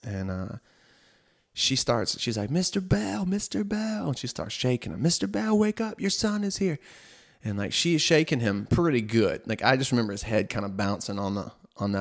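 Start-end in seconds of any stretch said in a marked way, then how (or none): 2.5-6.8 high, urgent nurse calling a name. Retold or imitated. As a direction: call him awake, pitched way up. Nurse calling and shaking patient.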